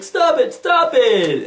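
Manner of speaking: high, whining plea to stop; whiny protest. Retold or imitated. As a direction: whine it like a pestered kid. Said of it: "Jason Todd's like".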